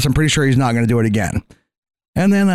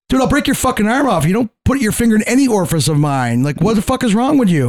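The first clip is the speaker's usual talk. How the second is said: higher, heated retold threat; angry threat; 7 semitones higher than usual. To the coworker who poked his ear, a heated higher threat. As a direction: warn him off, raised and hot.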